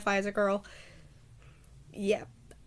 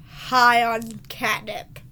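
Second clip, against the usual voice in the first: slow, stretched-out, emphatic delivery; deliberately drawn out; 5 dB louder, much slower than usual. Shown as a demonstration. Vlogger guarding against a takedown, deliberately drawn out. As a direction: drag the words out, loud on the first. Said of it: "I had to say it like that".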